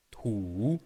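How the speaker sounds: "tu" modelled with a falling-rising tune; Mandarin syllable. Shown as a demonstration. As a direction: model "tu" for repetition. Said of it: "Tu peux les prononcer en même temps que moi".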